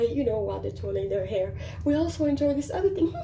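usual speaking voice

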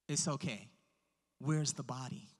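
low, soft, matter-of-fact; quietly unfazed, with a pause between phrases; calm Jesus. Retold or imitated. Jesus answering grieving Mary and Martha, calm and hushed.

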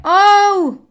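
sudden high squeal of fright; startled yelp. Real, not acted. Gamer startled by a bomb, high yelp. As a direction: jump at the blast. Bomb lands near her.

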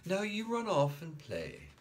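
high gentle shooing; mother shooing her little boy. A narrator's reading. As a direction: send him off kindly.

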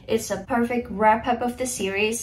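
normal talking voice

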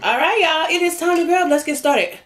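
ordinary delivery

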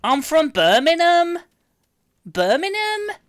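exaggerated high, loud Brummie accent, drawn out; Birmingham accent. Shown as a demonstration. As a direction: squeal it in a mock Birmingham accent. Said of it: "can we hear your best Birmingham accent".

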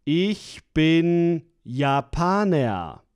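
normal talking voice

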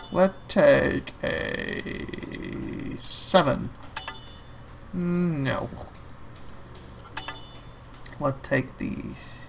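slow, breathy whisper to himself. Real, not acted. Gamer whispering his move to himself. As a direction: murmur the choice under the breath.

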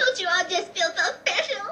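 high, laughing put-on voice; sing-song mocking voice with a laugh; silly voice. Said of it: "Not as special as that voice of yours".

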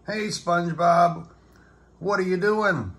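a simple pal calling over; Patrick voice. A narrator's reading. Patrick calling to SpongeBob in the yard, a high dopey voice.

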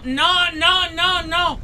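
high, loud repeated cries; alarmed shouting. Real, not acted. Alarma por el fuego. (gritos agudos de alarma)

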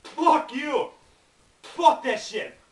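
real outburst at the sting; pained shout. Real, not acted. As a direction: yell out from the burning heat. Wasabi burning his mouth.

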